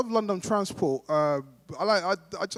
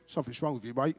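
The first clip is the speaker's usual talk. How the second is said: Cockney; 6 semitones lower, 6 dB quieter than usual. Acted. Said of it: "he went".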